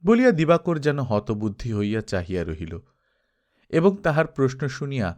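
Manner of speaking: usual speaking voice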